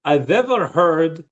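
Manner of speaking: imitated Slavic-accented English. Retelling the ex's reply to him, Slavic accent. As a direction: quote her in a Slavic accent. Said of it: "a very good Slavic accent".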